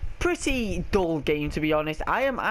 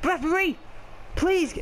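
shouting for the ref; 12 semitones higher than usual. Real, not acted. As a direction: yell for the referee. Appealing to the ref.

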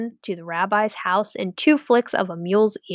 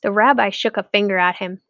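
ordinary delivery, nothing marked

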